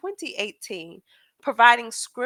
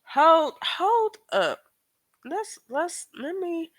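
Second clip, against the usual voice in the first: surprised; 8 semitones higher, slower than usual. Real, not acted. Disbelief at the misspelling.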